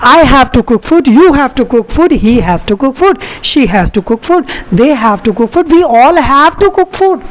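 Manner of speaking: slow, deliberate drill reading, each pronoun stressed. Shown as a demonstration. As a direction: spell out each sentence slowly for learners. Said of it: "मुझे खाना बनाना पड़ता है". (धीमा, साफ़ अभ्यास वाला उच्चारण)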